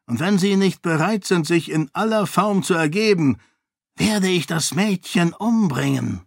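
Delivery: high, gloating monk voice with threat; menacing zealot. A narrator's reading. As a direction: lay out the ultimatum with cold glee, high pitched. Dilaf's ultimatum to king. (hohe, drohende, selbstgefällige Stimme)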